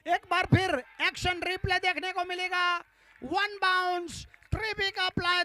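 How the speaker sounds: loud, rising, slow rhyming boundary call; excited cricket commentary. Real, not acted. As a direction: belt out the four like a chant. (जोशीली cricket commentary)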